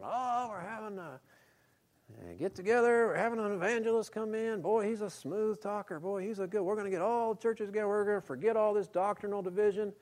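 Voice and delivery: high, fast gushing enthusiasm; gushing imitation. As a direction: play an overeager church promoter. Imitating an eager inviter.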